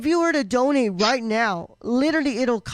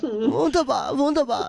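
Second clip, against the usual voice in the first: mock German accent; 2 semitones higher than usual. German gamer cheering the game, mock German accent. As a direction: approve in a hammed-up German accent. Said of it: "German accents".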